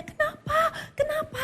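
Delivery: very high, crying out in alarm; a frightened wife sobbing the question; panicked cry. Retold or imitated. Wife shocked, husband holds knife. (istri panik menjerit sambil menangis)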